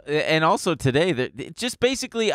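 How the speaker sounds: ordinary delivery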